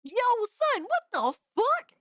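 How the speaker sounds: real shocked reaction; shocked yelp. Real, not acted. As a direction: cry out in stunned shock. Shocked by a cursed clip.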